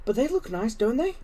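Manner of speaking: lightly voiced young girl; a hint of an excited little girl. A narrator's reading. Susan's voice in the story.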